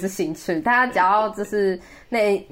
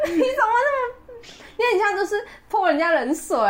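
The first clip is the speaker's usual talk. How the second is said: exasperated; 13 semitones higher, faster than usual. Real, not acted. Exasperated at his missed chance.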